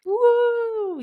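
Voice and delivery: high, loud celebratory woo; excited cheer. Real, not acted. Cheering one-year anniversary.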